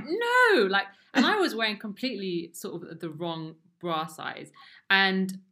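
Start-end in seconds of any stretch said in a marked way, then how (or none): 0.0-0.6 high, loud, drawn-out disbelief; stunned, with pitch soaring; shocked. Retold or imitated. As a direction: relive the shock on one word. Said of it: "I was just shocked".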